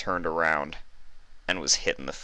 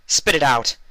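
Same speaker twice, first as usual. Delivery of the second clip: sharp, higher and louder command; impatient; 9 semitones higher, 7 dB louder than usual. Acted. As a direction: snap at him to hurry up. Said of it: "Celestia said impatiently".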